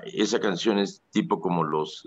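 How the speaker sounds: usual speaking voice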